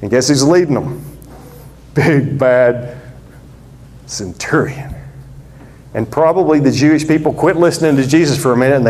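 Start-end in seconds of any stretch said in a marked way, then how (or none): 2.0-5.0 big bad centurion. Menacing villain build-up.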